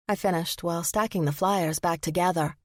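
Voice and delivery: ordinary delivery, nothing marked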